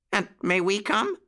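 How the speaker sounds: put-on high, hopeful puppy-boy question; eager. A narrator's reading. Jackie asks to come along too, eager high question. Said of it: "asked Jackie eagerly".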